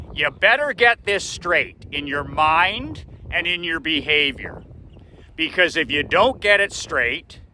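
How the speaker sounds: imitating a dad's emphatic ultimatum; stern warning. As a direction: press each consequence on your son. Dad warns son sternly.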